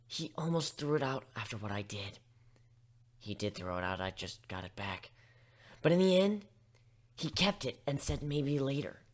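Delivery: ordinary delivery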